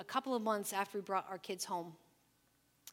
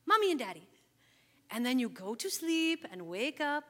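Ethiopian-English family talk; 3 semitones higher than usual. Retold or imitated. Mom to young daughter, explaining forever family in a light accent. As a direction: talk simply, in a gentle accent, for a small child. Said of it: "we talked a little bit like this".

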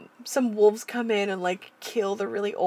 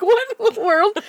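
high, loud laughing disbelief; baffled farmer; 14 semitones higher, 8 dB louder than usual. Retold or imitated. The farmer seeing the sheep obey the pig, laughing in disbelief. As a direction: let it burst out with a laugh, amazed. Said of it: "The farmer's just like".

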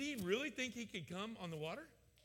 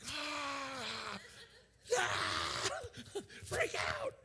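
mock freak-out; 8 semitones higher, much slower than usual. Preacher imagines seeing Jesus on the water, mock panic yelling. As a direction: ham up a listener panicking at a ghost. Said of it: "I'd be like".

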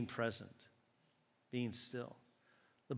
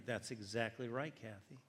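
ordinary delivery

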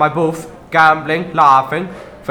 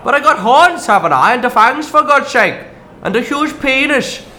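indignant rant; 7 semitones higher than usual. Acted. Ranting about his looks.

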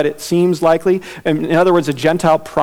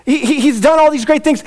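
mock gushing leaders; 9 semitones higher than usual. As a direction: overplay their admiration. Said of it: "they're saying".

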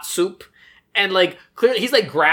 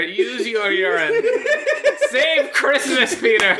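mock Santa; 6 semitones higher, slower than usual. Mock Santa cheering the kid.

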